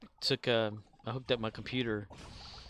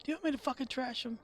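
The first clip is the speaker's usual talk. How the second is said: rising mock fury, higher and faster at the end; imitating a raging outburst; mock angry rant; 7 semitones higher, faster than usual. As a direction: ham up a furious director. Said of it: "quoting the Bale rant".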